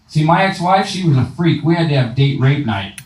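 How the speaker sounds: usual speaking voice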